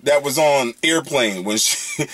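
ordinary talk, nothing marked

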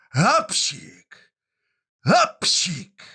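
two big high sneezes, hap-sik; loud acted sneezes. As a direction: sneeze loudly twice, rising the second time. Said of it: "I znowu kichnął dwa razy".

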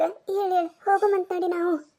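usual speaking voice

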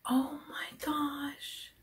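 gasp, then whisper slowly; stunned gasp. Real, not acted. Opening Jude's last surprise, gasp and whisper.

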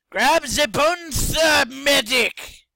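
slow, loud, high command; loud shout. Real, not acted. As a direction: bark out the order. Shouting to himself.

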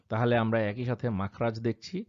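normal talking voice

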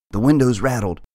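ordinary delivery, nothing marked

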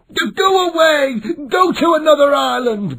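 high, loud angry monkey chatter; screeching monkeys. A narrator's reading. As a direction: scream them off the island like monkeys. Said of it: "they screamed".

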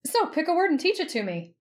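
high, perky request; playful heroine. A narrator's reading. Heroine playfully prods her partner.

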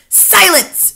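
high, loud order; commanding shout. A narrator's reading. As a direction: silence the room with one word. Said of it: "shouted the Grand High Witch".

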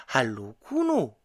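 cheerful called hello; calling out. A narrator's reading. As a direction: call the greeting up across the meadow. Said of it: "rief Kuno".

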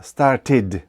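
"Started" as two syllables, "star-tid"; pronounced E in STARTED. Shown as a demonstration. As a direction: sound the vowel before the final D in "Started". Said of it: "в цьому випадку І вимовляється".

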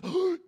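high, loud mock gasp; shocked gasp. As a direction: gasp as the scandalised officer. Officer shocked.

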